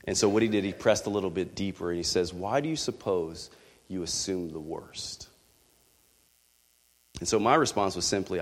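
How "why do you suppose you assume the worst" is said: hushed, low-key question; quietly probing, as a caring friend; gentle probing. Retold or imitated. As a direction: press softly, almost whispering. Friend gently presses deeper.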